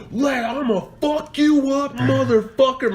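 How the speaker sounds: high-pitched menacing dad impression; angry dad. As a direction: imitate dad charging in with a threat. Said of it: "my big ass dad is fucking walking towards him".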